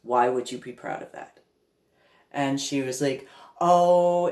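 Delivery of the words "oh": dismissive oh. Retold or imitated. Mimicking Pam brushing it off.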